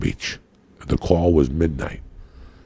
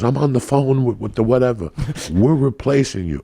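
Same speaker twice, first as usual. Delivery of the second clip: higher whiny mock director voice; 8 semitones higher than usual. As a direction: lightly mimic a flustered director. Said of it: "He goes".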